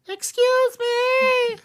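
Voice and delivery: drawn-out shrieking EXCUSE ME, very high and slow; Vicky Guerrero impression. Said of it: "That's a very good Vicky".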